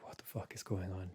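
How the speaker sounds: whispered bewilderment, very soft; barely voiced, baffled whisper to oneself; hushed retold thought. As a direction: whisper it as if alone in the dark. Retold thought during nighttime scare.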